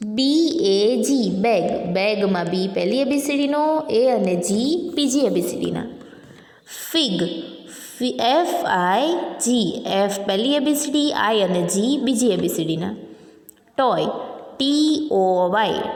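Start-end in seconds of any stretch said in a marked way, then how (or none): 0.1-1.3 slow letter-by-letter spelling. Shown as a demonstration. Spelling out a word for pupils. 8.2-9.8 slow letter-by-letter spelling. Shown as a demonstration. Spelling out a word for pupils. 14.6-15.8 slow letter-by-letter spelling. Shown as a demonstration. Spelling out a word for pupils.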